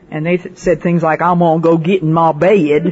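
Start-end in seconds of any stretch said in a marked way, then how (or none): 1.2-2.8 grandchild's Southern accent, lightly mimicked. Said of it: "If you've got a Southern accent".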